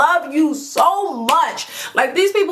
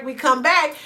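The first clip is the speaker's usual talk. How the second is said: ordinary talk, nothing marked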